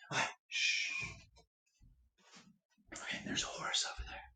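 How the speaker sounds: hushed sneaky whisper. Pretend. Sneaking up on horse.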